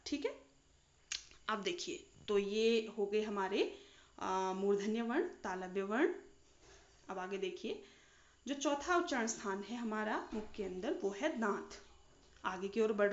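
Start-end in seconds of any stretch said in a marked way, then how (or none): none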